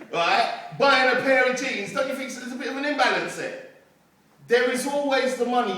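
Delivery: rallying. Real, not acted. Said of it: "motivational speech to crowd".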